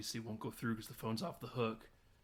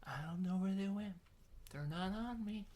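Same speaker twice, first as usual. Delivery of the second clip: put-on higher, softer, slurring drunk mom; 7 semitones higher, 4 dB quieter than usual. Drunk mom on couch answering about keys, higher and soft. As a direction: mumble like a tipsy mother on the couch. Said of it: "the mom's ... toasted on the couch".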